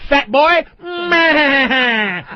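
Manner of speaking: hammed-up old movie gangster whine; mock a whiny woman as a film gangster, stretching the cries; Edward G. Robinson. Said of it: "Edward G. Robinson voice with titties".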